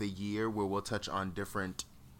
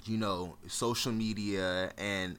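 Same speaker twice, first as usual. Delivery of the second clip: normal talking voice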